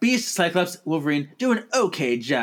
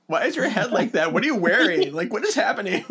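incredulous questions through laughter; laughing disbelief; 4 semitones higher, faster than usual. Retold or imitated. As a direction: laugh while asking. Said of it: "I'm like".

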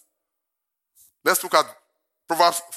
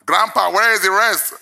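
put-on high, loud child's question; a kid demanding his missing share; mock child voice, high and loud; grandson asking; 7 semitones higher, 9 dB louder than usual. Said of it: "What if he says".

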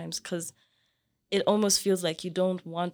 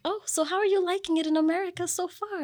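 high, singled-out imitation of her question; old lady neighbor; 11 semitones higher than usual. Neighbor asks a foreign student about America, high imitated voice. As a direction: pitch it up as the inquisitive neighbor. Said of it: "this old lady".

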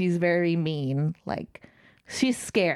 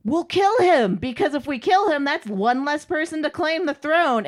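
higher, punchy mock threat; 7 semitones higher, 4 dB louder than usual. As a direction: act out bullying brothers, pitched up. Brothers threaten to kill him.

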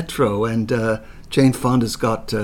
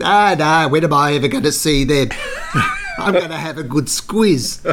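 cheeky Aussie mate; 5 semitones higher, 6 dB louder than usual. Retold or imitated. John's cheeky reply retold.

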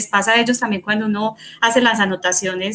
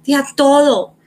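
quoting niece; 4 semitones higher, 5 dB louder than usual. Retold or imitated. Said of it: "Ella me dice".

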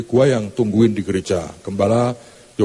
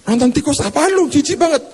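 disgusted church lady. Acted. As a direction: sneer at the mouse trip.

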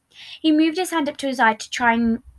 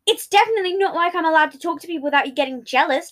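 heated, higher retort; arguing back; 6 semitones higher, 5 dB louder than usual. Acted. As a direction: fire back defensively. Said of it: "Deceit argued back".

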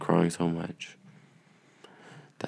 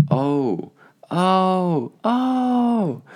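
climbing higher yawning oh; big yawn; 14 semitones higher, much slower than usual. Real, not acted. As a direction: let the yawn rise up. Yawning sounds rising.

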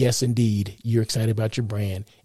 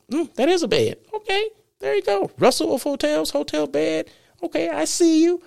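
much higher put-on voice of a viewer who suddenly gets it; imagined viewer realizing. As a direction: act the listener finally seeing the bed, high and amused. Imagines viewer spotting the bed.

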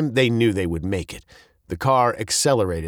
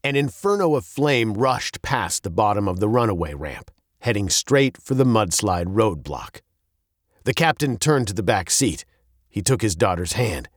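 ordinary delivery

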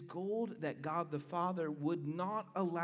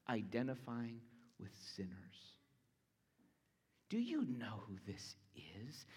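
awed hush; 4 semitones lower, 6 dB quieter than usual. Real, not acted. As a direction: drop to a whisper in awe. Awed hush over Christ's glory.

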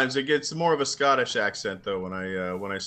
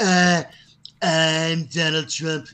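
high, slow bad Trump impression; 7 semitones higher, 4 dB louder, much slower than usual. A bad Trump to impress the podcast friends. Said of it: "where I just go".

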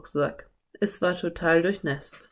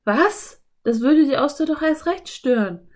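put-on high, louder girl's protest; indignant; 8 semitones higher, 5 dB louder than usual. A narrator's reading. Anne objects to striking the oyster, indignant high voice. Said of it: "widersprach Anne empört".

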